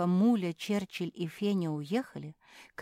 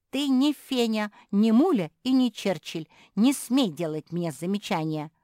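loud, higher defiant retort; snapping back; 5 semitones higher, 7 dB louder than usual. A narrator's reading. Younger sister rejecting a scolding, snapping back. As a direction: talk back insolently to an older sister. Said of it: "огрызнулась та".

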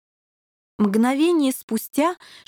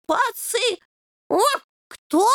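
much higher, baffled squeak; stunned; 12 semitones higher, slower than usual. A narrator's reading. Puppy asks the devil, stunned by the word. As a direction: stumble on the strange word. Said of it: "ошеломлённо переспросил".

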